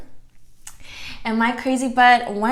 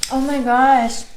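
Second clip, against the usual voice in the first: a louder, thrilled oh my gosh; loud delighted reaction to the first bite; delighted exclamation; 3 semitones higher, 7 dB louder, slower than usual. Real, not acted. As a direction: react as the taste hits. Reacting to tasting food.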